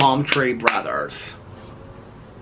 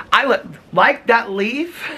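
cooing over leaf; 13 semitones higher, 4 dB louder, much slower than usual. Real, not acted. Admiring a leaf.

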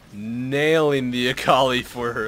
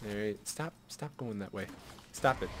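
usual speaking voice

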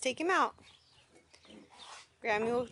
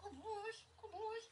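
squeaky praising a dog; 6 semitones higher, 8 dB quieter, slower than usual. Real, not acted.

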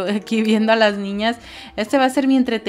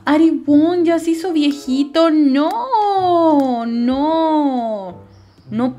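louder, higher cry of disbelief; dismayed exclamation; 5 semitones higher, 5 dB louder, much slower than usual. Real, not acted. Player dismayed that her sim aged: loud, high "no, no". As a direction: protest loudly that it can't be true.

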